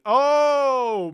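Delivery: big high groaning oh; drawn-out loud oh; loud exasperated oh. Real, not acted. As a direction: let out a loud fed-up oh.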